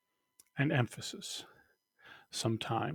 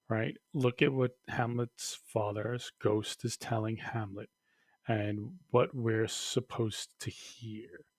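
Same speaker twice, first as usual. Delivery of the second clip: usual speaking voice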